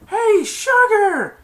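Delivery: high, loud, drawn-out holler; imitated catcall. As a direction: holler it across the field. Player calls out to kicker.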